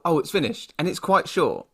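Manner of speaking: normal talking voice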